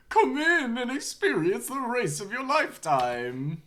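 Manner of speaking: high, slow, loud carnival clown; silly clown. Acted. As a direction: bark it like a carnival clown barker. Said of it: "I don't know if I have a good clown voice".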